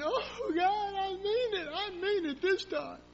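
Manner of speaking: high, crying plea to God; mock weeping prayer. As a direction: wail it like a hammed-up altar sob. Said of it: "wept and cried".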